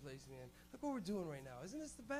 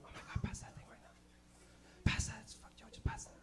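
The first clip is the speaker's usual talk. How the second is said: hiding whisper; 14 semitones higher, 4 dB quieter than usual. Retold or imitated. Teen stoners hiding in a garage, hushed urgent whisper. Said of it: "in someone's garage going".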